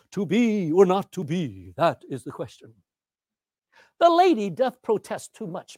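slow, theatrical declamation; big opening, drawn-out line endings; Shakespeare recitation. Acted. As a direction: declaim the verse grandly, stretching the words. Recites famous Shakespeare lines from the stage.

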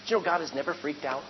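ordinary delivery

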